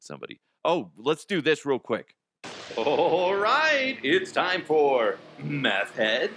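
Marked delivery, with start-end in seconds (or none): 2.8-6.3 big, loud game-show announcer call; game show host. Acted. Host to the audience, introducing the quiz in a booming game-show voice. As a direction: sell the segment title like a TV host.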